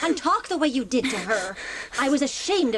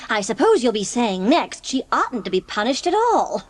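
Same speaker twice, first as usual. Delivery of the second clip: ordinary delivery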